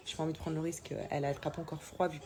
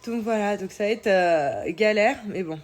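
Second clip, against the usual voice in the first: louder; 4 semitones higher, 10 dB louder, slower than usual. Real, not acted. Complaining about getting sick.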